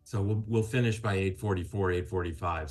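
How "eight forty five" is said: ordinary talk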